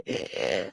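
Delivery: exaggerated mocking gasp for air. Mocking a rival's breathlessness with an exaggerated gasp. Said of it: "what are you out of breath from?".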